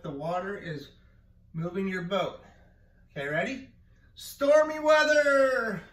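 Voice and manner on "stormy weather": loud, high, slow drawn-out call; called command. Shown as a demonstration. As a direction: call it like a storm warning. Teacher calls game command.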